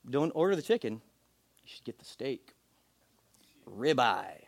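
low, hushed mock voice of God; mock divine prompting. God advising a diner on what to order, hushed mock solemnity. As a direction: drop low and soft for a solemn pronouncement.

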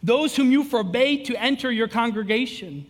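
slow, solemn reading of a lament; scripture reading. Acted. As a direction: read each line slowly and gravely.

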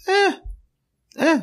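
high, dismissive eh-eh; lightly mocking dismissive grunt. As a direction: shrug it off with a scoffing eh. Sage dismisses himself, story.

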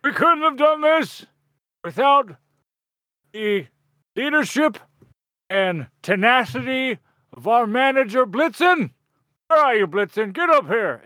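grand, high wrestler speech to the fans; wrestling promo. Acted. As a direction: hype up the crowd like a wrestler on the mic. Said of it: "I'm gonna say".

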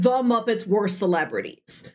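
ordinary delivery

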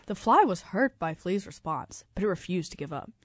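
ordinary delivery

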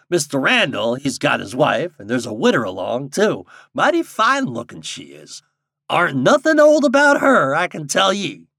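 high, loud country guide with dialect; old guide passing on gossip in rustic speech; rustic old guide, mischievous. Acted.

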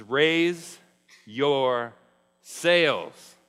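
each word hit loud with a pause between; loud command. Acted. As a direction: call out Raise, your, sails word by word. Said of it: "He says".

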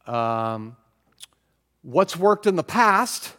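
normal talking voice

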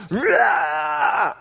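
high shouted monster roar. Retold or imitated. Sully roaring in the scare room, monster roar. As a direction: roar like Sully scaring Boo. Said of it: "Sully's doing the scare thing".

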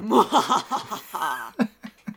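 high, drawn-out villain cackle; mock-villain "mwahaha"; evil mastermind laugh. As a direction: let out a hammed-up evil laugh. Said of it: "the malicious, uh, evil mastermind".

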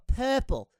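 'Purple' as PUH-pul, the R dropped; old childhood purple. Retold or imitated. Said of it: "I would go".

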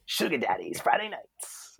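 soft, swooping club-promo voice; hushed radio plug impression; Tony Monaco. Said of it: "do a really good Tony Monaco".

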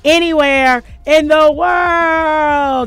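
slow booming villain laugh through the words; evil laugh. Acted. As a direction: cackle it out slow and loud like a villain. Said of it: "I didn't know we were going devilish".

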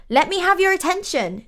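put-on high, loud German-accented call for attention. A narrator's reading. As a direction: call the room to order, lightly German-accented. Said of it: "she said in her German accent".

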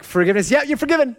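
mock quick forgiveness. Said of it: "some of you are like".

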